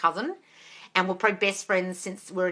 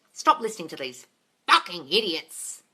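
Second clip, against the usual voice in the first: usual speaking voice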